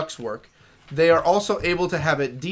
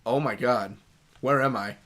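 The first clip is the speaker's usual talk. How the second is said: ordinary talk, nothing marked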